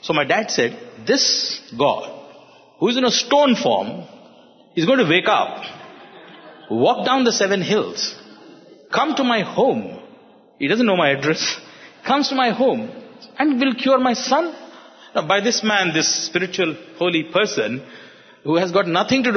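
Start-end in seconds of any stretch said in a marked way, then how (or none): 1.1-9.8 high-pitched, spaced out phrase by phrase; retold sceptical father, pitched up in disbelief; imitated incredulous scorn. Dad mocks the idea, step by step. 12.1-14.5 high-pitched, spaced out phrase by phrase; retold sceptical father, pitched up in disbelief; imitated incredulous scorn. Dad finishes his incredulous challenge.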